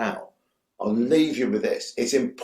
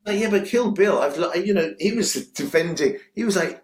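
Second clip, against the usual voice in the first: ordinary talk, nothing marked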